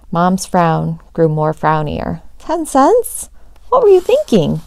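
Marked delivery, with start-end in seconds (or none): 2.4-4.6 high, loud incredulous mom; shocked disbelief. A narrator's reading. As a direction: shriek it in disbelief. Mom shocked at low price.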